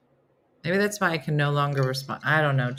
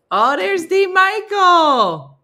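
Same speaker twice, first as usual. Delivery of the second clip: loud, higher, very slow; delighted greeting; 12 semitones higher, 8 dB louder, much slower than usual. Real, not acted. Welcoming D Michael into the stream, delighted. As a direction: call out happily to a friend.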